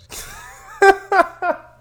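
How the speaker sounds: high-pitched burst of laughter; loud laugh. Real, not acted. Co-host cracking up after the sign-off, loud high laugh. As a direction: crack up loudly.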